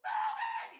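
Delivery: piercingly high mock kid shriek; imitating yelling child. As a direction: shriek out the car window like a little boy. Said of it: "He's, like, yelling at the cars".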